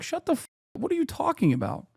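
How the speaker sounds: high-pitched incredulous retort; mock outraged bully. Acting the public shutting up a nerd, mock outrage. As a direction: act out a baffled put-down, pitched high. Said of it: "they'd be like".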